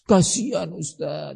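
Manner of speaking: soft, trailing plea for pity; mock pleading parent. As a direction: imitate a parent begging the preacher, fading away. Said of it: "atas nama kasih sayang".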